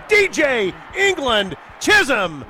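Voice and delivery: name shouted, stretched slowly; shouting. Real, not acted. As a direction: bellow the scorer's name. Shouted name after touchdown.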